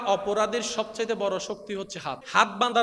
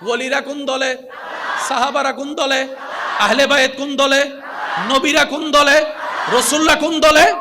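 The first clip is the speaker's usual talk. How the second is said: loud rallying calls, building higher; preacher calling out to the crowd, louder each time; call-and-response preaching; 3 semitones higher, 7 dB louder than usual. Acted.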